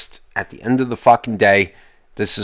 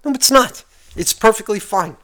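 defiant denial; 11 semitones higher than usual. Real, not acted. Defiant answer to emails.

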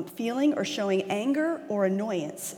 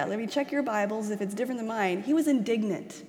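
ordinary talk

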